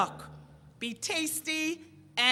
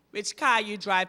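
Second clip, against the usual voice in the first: ordinary talk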